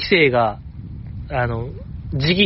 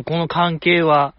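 ordinary talk, nothing marked

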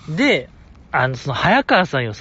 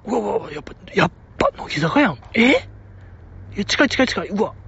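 starstruck fan; 4 semitones higher, 3 dB quieter, faster than usual. Retold or imitated. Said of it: "ってなるでしょ".

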